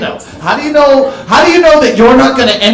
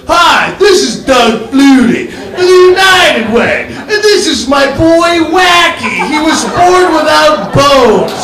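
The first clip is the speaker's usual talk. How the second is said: charity ad voice; 5 semitones higher, slower than usual. Retold or imitated. Doug Flutie pleading for charity, hammed-up slow. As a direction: ham up a telethon plea. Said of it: "every other quarterback".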